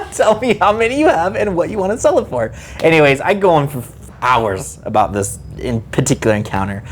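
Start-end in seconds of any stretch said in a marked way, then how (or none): 0.1-2.5 laughs it out, pitch up; exasperated, half laughing; laughing frustration. Real, not acted.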